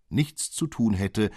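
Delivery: ordinary delivery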